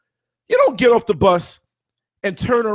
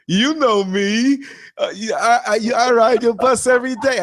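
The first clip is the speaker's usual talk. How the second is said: pleading passenger; 2 semitones higher than usual. Retold or imitated. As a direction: stretch the first words like a clingy passenger. Passenger answers the driver.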